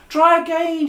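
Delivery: high, loud desperate plea; trapped man pleading loudly. Acted. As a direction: beg the boy to try again. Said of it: "he pleaded".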